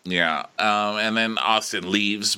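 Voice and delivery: ordinary talk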